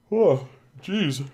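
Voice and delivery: high yawning exclamation. Real, not acted. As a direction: groan it out through a yawn. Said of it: "I'm yawning so much".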